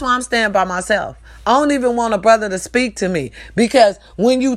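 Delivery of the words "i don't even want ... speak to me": ordinary delivery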